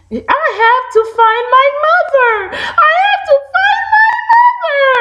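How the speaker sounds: high sobbing baby bird, hammed-up; crying baby bird. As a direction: wail it like a lost chick. Baby bird searching, upset.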